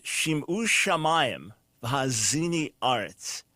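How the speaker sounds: slow, careful Hebrew reading; Hebrew recited. Shown as a demonstration. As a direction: read the Hebrew verse slowly and deliberately. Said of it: "starts out with".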